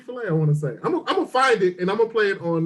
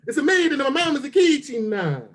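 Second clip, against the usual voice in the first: broad, hammed-up Italian accent; one more cartoonish Italian flourish; sing-song mock Italian; Italian impression; 3 semitones higher than usual. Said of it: "magnificent Italian impression".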